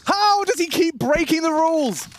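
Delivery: high, loud disbelieving yell; excited shout. Real, not acted. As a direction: scream it in disbelief. Caster yells at clutch.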